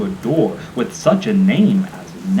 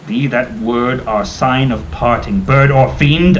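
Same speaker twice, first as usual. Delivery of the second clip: louder, rising to a shrieked 'fiend'; 5 dB louder, slower than usual. Acted. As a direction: fling it at the bird in fury. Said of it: "I shrieked upstarting".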